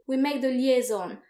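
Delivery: normal talking voice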